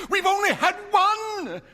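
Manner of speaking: high, loud eager reply; excited. A narrator's reading. Wife telling her husband of the wishes, high and eager. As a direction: rush it out eagerly.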